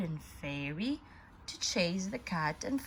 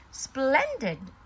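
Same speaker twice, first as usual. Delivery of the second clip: higher, louder gleeful cry; delighted exclaim; 5 semitones higher, 5 dB louder than usual. A narrator's reading. Cat pleased the coins are buried, sly glee. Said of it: "exclaimed the cat".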